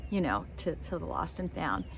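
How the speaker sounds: ordinary talk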